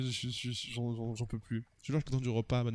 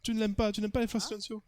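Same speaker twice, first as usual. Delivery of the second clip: flustered suitor; 9 semitones higher than usual. Acted. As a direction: apologise like a smitten, flustered admirer.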